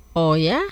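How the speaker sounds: "Oh ya" rising at the end, as a question. Shown as a demonstration. Teacher models a reaction for learners, "Oh ya" as a rising question. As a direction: ask "Oh ya" like "really?", ending on a rise.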